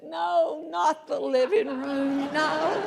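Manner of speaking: high, drawn-out mock wail of the son; panicked teen son. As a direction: play the dreading kid, a bit high and slow. Son dreads the living room.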